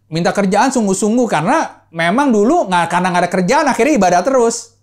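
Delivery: loud, high, fervent sermon voice; preaching at full force. Acted. Pastor exhorting the congregation, preaching loud and high. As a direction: drive the point home to the congregation.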